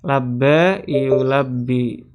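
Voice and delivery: "Labba yulabbi" drawn out syllable by syllable; teacher models "Labba yulabbi" very slowly for students. Shown as a demonstration. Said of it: "Dari kata-kata labba yulabbi".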